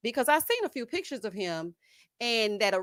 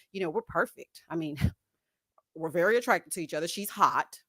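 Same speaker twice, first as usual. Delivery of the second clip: low, mock-sincere man's voice; lower, hammed-up boastful boyfriend; faking boyfriend; 4 semitones lower than usual. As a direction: imitate him putting on an act. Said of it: "I knew he was faking".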